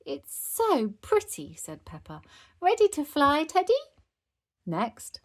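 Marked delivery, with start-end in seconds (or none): 0.1-1.5 put-on high, slow child's coo; pleased little-girl voice; delighted admiration. A narrator's reading. Child admiring her decorated broomstick. 2.6-3.7 high, loud playful question; pitched-up little-girl voice; eager child. A narrator's reading. Child talking to her teddy.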